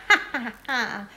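gleeful wicked cackle; witch's cackle. A narrator's reading. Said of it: "she cackles".

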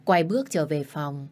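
plain reading voice

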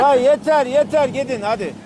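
loud high call across the water. Real, not acted. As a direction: shout it out to the others. Calling out to the boat.

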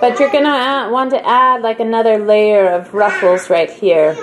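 booming, very loud and high. Acted. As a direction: announce it across the room. Loud shouted sewing tip.

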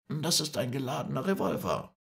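high-pitched, controlled announcement; negotiating firmly. Acted. Moreau negotiates from the shore. (hoch und beherrscht angekündigt)

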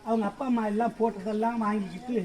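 ordinary talk, nothing marked

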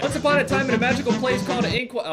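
sing-song once-upon-a-time voice, played up; storybook narrator. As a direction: read it like a fairytale narrator. Mock reading of storybook opening.

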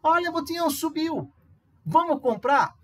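eager student. Pretend. Aluno imaginado empolgado.

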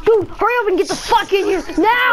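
urgent shout; being chased. Real, not acted. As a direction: yell for them to hurry in. Urging friend inside from zombies.

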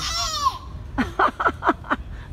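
high delighted giggle; high laugh. Real, not acted. Laughing as the parakeets screech, a high giggle.

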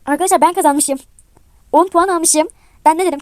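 loud, elated victory announcement; excited triumph. Real, not acted. Player tells viewers about winning the round, excited. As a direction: burst out loudly, proud of the win. Player learns they have won.

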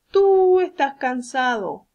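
Tú stretched long and loud; stress on Tú. Shown as a demonstration. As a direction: lean hard on Tú, then slowly finish. Teacher models the pronoun tú.

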